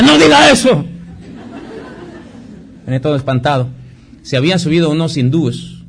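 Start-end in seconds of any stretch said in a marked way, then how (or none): 0.0-0.8 chillido agudo y fuerte de miedo; asustado. Retold or imitated. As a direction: suplica espantado, muy agudo. 95 Venía todo espantado. (el joven espantado en el avión suplica con voz aguda)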